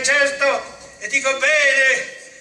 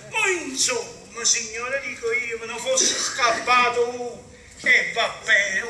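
ordinary talk, nothing marked

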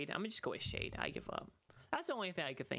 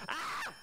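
startled yelp; 12 semitones higher than usual. Real, not acted. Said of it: "I didn't get scared".

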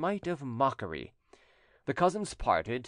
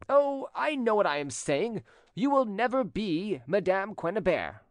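high-pitched, emphatic certainty; insistent rejected suitor; 9 semitones higher than usual. A narrator's reading.

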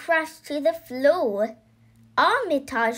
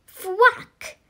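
high, sharp exclaimed thwack; sudden high-pitched impact word; mallet thwack; 8 semitones higher, 4 dB louder than usual. A narrator's reading. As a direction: punch it out like a blow landing. Narrator voices the mallet blow.

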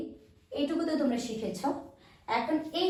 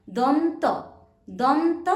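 দন্ত called out louder and rising; emphatic naming; 3 semitones higher, 6 dB louder, much slower than usual. Shown as a demonstration. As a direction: call out দন্ত like a quiz answer. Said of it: "আগে কি বলবো?".

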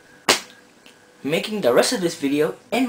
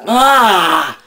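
startled ah; 5 semitones higher, 8 dB louder than usual. Acted. Sudden reveal.

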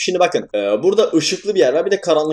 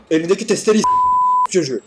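higher-pitched burst of real annoyance; angry outburst; 6 semitones higher than usual. Real, not acted. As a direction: snap at the enemy with the saw. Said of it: "Pardon, sinirlendim biraz". (testereli düşmana sinirli, yüksek perdeden patlama)

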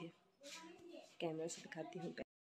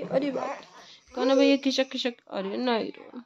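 soothing; 6 semitones higher, 8 dB louder than usual. Real, not acted. Mother soothing crying son.

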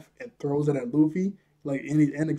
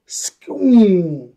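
loud, high mouth-made sound effect; vocal swoosh for a sword move; punchy cartoon attack noise; imitated whoosh; 4 semitones higher, 10 dB louder than usual.

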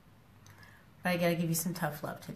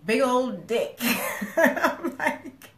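high, loud laughing outburst; laughing retelling of a shock; 5 semitones higher, 5 dB louder, much slower than usual. Real, not acted. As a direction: laugh through it in disbelief. Laughing at dick pic surprise.

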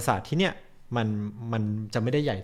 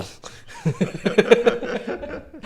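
high breathy laugh; 11 semitones higher, 3 dB louder than usual. Real, not acted. Reacting to the pronunciation attempt.

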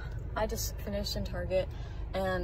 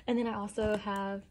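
whispering. Real, not acted. Whispered aside.

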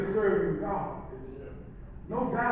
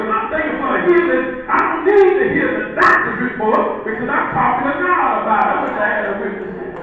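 loud, rising preacher's cry; preaching at full force; 4 semitones higher, 12 dB louder than usual. Acted. Preacher urging the congregation, loud and climbing. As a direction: build the fire and shout it out.

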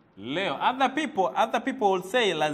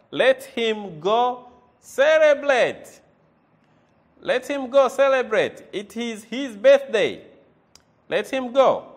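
loud model sentence; 4 semitones higher, 9 dB louder than usual. Shown as a demonstration. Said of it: "model sentence read loud".

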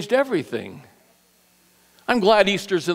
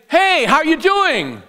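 high-pitched, chirpy informal hello; casual greeting; 8 semitones higher than usual. Acted. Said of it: "The common informal way somebody".

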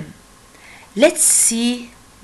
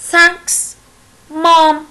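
put-on happy child; 5 semitones higher than usual. Child thanks and greets mom.

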